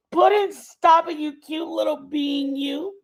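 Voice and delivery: loud, high mock telling-off; gushing, affectionate scolding; playful cutesy scolding. Real, not acted. Playful telling-off of cute being.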